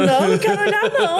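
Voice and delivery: high-pitched mock panic; mother's squeamish refusal, imitated. Mother refusing to look at rat. (imitando a mãe recusando, apavorada)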